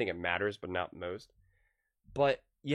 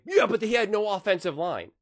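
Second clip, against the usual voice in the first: higher, louder mock protest; mimicked objectors; 8 semitones higher, 6 dB louder than usual. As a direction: imitate fans making excuses, pitched up and loud. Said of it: "people always wanna say".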